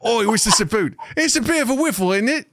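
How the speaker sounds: high-pitched, hammed-up regional British; exaggerated British dialect. Shown as a demonstration. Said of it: "slip into different regional dialects".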